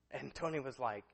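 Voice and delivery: normal talking voice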